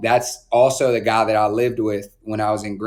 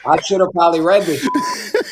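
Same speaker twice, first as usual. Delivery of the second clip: laughing at yourself, higher and louder; 6 semitones higher, 4 dB louder than usual. Real, not acted. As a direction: laugh through the admission, breaking up. Laughing at own past mistake.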